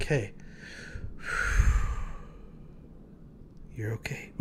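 soft unvoiced whisper to himself; whispered reassurance. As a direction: breathe it out gently, as if to himself.

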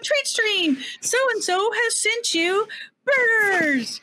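high, slow alert readout; notification announcer voice. Retold or imitated. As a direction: read it like an app announcing a gift. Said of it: "it pops up".

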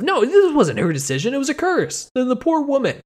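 high-pitched, worked-up objection; indignant protest. Real, not acted. Host defends the poor cursed woman, indignant. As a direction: protest on her behalf, voice shooting up.

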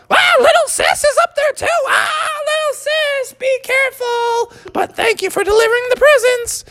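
very high falsetto cartoon mother; relenting mom. Mom lets her child finish the night, high relenting voice. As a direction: give in, keeping the piping mom voice. Mom reacts to little sis.

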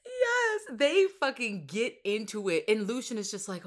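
ordinary talk

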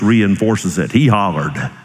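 ordinary delivery

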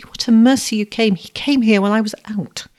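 high, tearful-sounding gush; sobbed. A narrator's reading. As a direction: pour it out in acted distress and relief. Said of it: "sobbed Susie".

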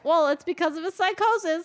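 mock excuse. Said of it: "You could be like".

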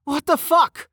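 very high, outraged yelp; yell. A narrator's reading. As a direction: yelp it high in shocked protest. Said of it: "he yelled".

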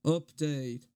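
slow, deliberate peace-and-love voice. Retold or imitated. Reciting his favourite fan-mail video, slow [name] voice. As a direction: warn them slowly with peace and love. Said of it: "I've memorized this".

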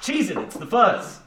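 mock Bender-style tough guy; seventies cop-movie catchphrase. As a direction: bark it like a crook in a caper. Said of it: "combine Bender from Futurama with a seventies phrase".

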